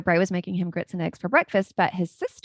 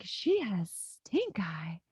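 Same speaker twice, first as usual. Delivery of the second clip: slow secretive whisper; whispered quote; 6 dB quieter, slower than usual. Retold or imitated. Mom retelling her son's secret gossip, whispered slowly. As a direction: hush it like telling a secret. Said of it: "he was like".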